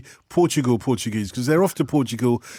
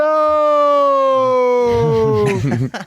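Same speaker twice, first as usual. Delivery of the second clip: loud high burst of laughter; 10 semitones higher, 6 dB louder than usual. Real, not acted. Host bursts out laughing on air.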